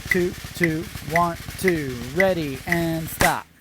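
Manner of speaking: slow counting over the drums; counting off the band. Shown as a demonstration. Drum teacher counting students through the exercise, slow call-out. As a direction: call the count while playing, slow and steady. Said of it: "let's go ahead".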